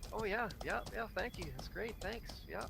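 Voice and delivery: high, eager little thank-yous; meek grateful guest. Retold or imitated. Guest dutifully thanking an overbearing host, high and eager. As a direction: hurry to please the host.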